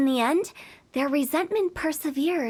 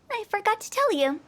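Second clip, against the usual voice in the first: normal talking voice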